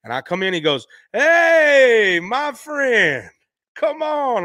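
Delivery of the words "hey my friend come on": high, loud, beaming welcome; imitating B B King. B B King welcoming the last fan in line, high and loud. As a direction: call him over like an old friend, much higher and louder. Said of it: "like this".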